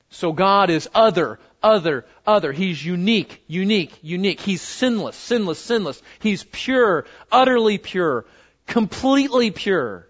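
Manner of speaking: each repeated word struck firmly; emphatic repetition. Shown as a demonstration. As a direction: hammer every repeat home. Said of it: "It's emphatic".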